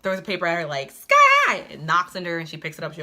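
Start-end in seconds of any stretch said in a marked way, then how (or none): 0.9-1.6 call out. Retold or imitated. Boy calls out to her.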